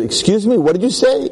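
high-pitched, incredulous questioning; mock-astonished trooper, voice rising; acted disbelief. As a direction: ask as if you can't believe your ears. Trooper reacts to duck claim.

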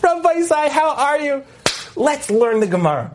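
high, sing-out welcome through a big smile; beaming cheerful greeting. Retold or imitated. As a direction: greet the class as if nothing happened. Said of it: "walks in with the biggest smile".

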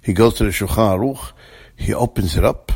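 ordinary talk, nothing marked

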